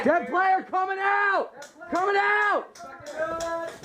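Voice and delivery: high, long drawn-out call; dead player callout. Real, not acted. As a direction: call it across the field, slow. Calling out as eliminated.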